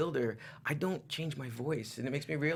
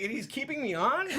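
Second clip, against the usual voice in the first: incredulous; 12 semitones higher, 3 dB louder than usual. Retold or imitated. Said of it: "I'm like".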